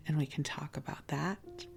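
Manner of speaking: ordinary talk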